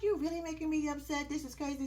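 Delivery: high, put-on upset complaint; mock upset. Girlfriend complains to boyfriend mid-argument, upset.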